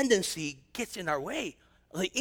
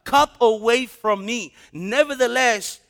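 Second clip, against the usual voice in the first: louder, slowing, emphatic scripture; full-force preaching; 6 dB louder than usual. Acted. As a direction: drive home Jesus' words in the garden.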